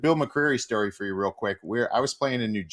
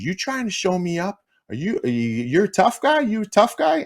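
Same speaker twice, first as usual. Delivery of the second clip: mocking challenge, pitched much higher and rising; taunting referee; 10 semitones higher than usual. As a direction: needle him like a ref daring a player. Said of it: "he goes".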